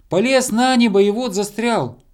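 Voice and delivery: high-pitched explaining call; squirrel shouting. A narrator's reading. Squirrel on star telling those below, high shout. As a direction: shout the news down from above. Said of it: "крикнула со звезды белка".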